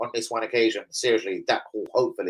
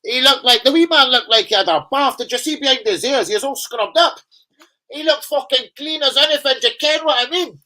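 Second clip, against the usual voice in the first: high, loud Scottish banter; Scottish accent; 10 semitones higher, 5 dB louder than usual. Retold or imitated. Scottish fan gossiping to viewers, in a Scottish accent. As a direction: do a quick Scottish bloke chatting football. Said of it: "I have to do a quick Scottish one for you".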